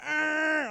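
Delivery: loud high angry growl; mom's growl. Retold or imitated. Mom waiting in the car, loud angry growl.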